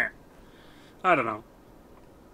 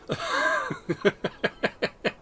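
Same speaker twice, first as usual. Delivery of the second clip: a sudden high giggle; high squeaky laugh. Real, not acted. Laughing at his own inner dialogue, high squeaky laugh.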